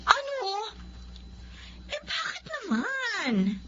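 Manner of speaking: high, drawn-out stunned question; can't believe what she heard; high, slow, stunned wife; shocked disbelief. Acted. Wife shocked at husband's refusal.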